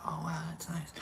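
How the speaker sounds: very soft, voiceless murmur to oneself; whisper. Acted. Streamer acting out enjoying a self-hug, whispered. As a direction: whisper it as a private little reaction.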